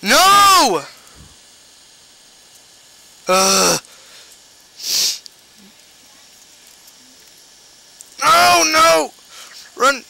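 high panicked shouts; being chased. Real, not acted. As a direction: yell in fright while fleeing. Fleeing big slimes.

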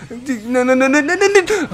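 rising pleading no, no, no; frantic gamer denial; panicked no's. Real, not acted. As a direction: beg the game to stop, pitch climbing. Panics as a match goes wrong.

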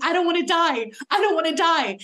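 retold tearful plea. Actor retelling a scenario where they beg for life, mock tearful plea. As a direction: plead for your life as if crying, only lightly acted.

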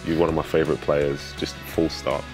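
normal talking voice

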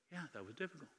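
barely voiced, retold answer; hushed admission.

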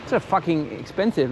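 normal talking voice

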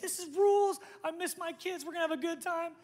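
high, giddy mom voice, way up in pitch; gushing mom. Retold or imitated. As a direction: squeal it like a thrilled mom. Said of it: "half you moms are like".

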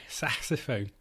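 lightly put-on Scouse "Saxophone"; Liverpudlian. Shown as a demonstration. Having a go at a tricky accent, mild Scouse. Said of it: "It's a tricky accent to do".

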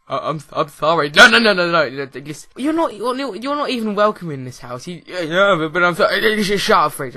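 loud, high mock shouting match; hammed-up row with a fridge; playfully yelling at the fridge like a spouse; mock argument. Acted quarrel with the fridge.

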